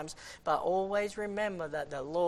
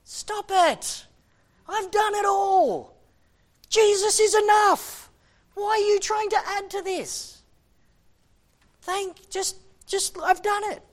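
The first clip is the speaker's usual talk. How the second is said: much higher and louder, exasperated cry; God's exasperated shout; 13 semitones higher, 9 dB louder than usual. Acted. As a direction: cry out as God, fed up with our trying. Voicing God, shouting.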